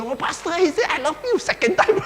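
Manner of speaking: high squealing delight with a laugh; excited screaming. Retold or imitated. Wife telling her pastor about her husband, squealing with laughter. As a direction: shriek the news with giggling joy.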